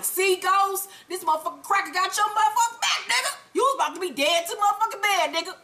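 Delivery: high, rapid told-you-so rant; hyped scolding. Pretend. Viewer addressing Ghost after his rescue, high excited scolding. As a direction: pitch it up and rattle it off.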